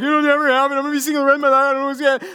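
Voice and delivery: high, loud, blurred whiny wail; mock despairing single. Pastor mimicking singles who doubt, mock despair. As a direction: mimic the doubters in a hammed-up panic.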